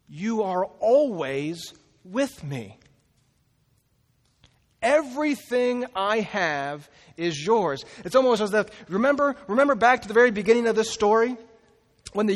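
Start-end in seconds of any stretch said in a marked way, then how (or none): 0.1-7.8 slow, gentle reassurance from a loving father; father tenderly reassuring his angry older son. Retold or imitated. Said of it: "He gives love".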